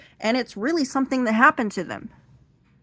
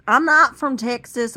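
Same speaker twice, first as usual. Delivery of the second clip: Texas accent; 4 semitones higher, 7 dB louder than usual. Shown as a demonstration. Speaker shows off the Texas accent they can use, Texas accent. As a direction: put on a Texas twang, loud. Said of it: "I can use this accent if I want to".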